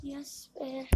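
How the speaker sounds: whispered little cheer; whispering. Real, not acted. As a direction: breathe it out unvoiced. Quiet win to himself.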